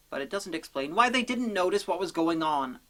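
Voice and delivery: usual speaking voice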